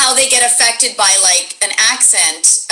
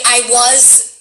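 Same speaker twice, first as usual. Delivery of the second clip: ordinary delivery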